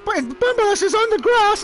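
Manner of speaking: high-pitched, drawn-out English commentator shout; Murray Walker impression. Attempting a tribute to Murray Walker, high-pitched commentary. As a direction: strain up high and loud like an excitable commentator. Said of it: "have that high-pitched voice like that".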